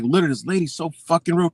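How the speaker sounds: ordinary talk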